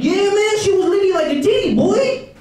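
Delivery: put-on a giddy drunk teen hollering back; young party bro. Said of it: "They're like".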